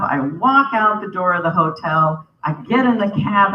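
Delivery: usual speaking voice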